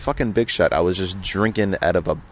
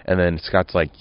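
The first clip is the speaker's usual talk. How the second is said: usual speaking voice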